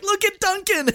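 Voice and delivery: high-pitched playful exclamation; retold delighted reaction. Podcast guest teasing his friend about an accent, retold delight. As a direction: pipe up high, as if tickled by a friend.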